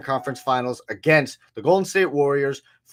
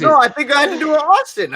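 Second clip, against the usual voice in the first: excited protest; 12 semitones higher, 10 dB louder than usual. Real, not acted. Protesting the excuse charge.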